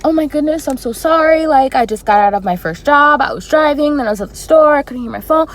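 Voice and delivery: high, loud, rushed panicked apology; freaked out. Retold or imitated. Missing the recruiter's call, panicked high apology. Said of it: "I freaked".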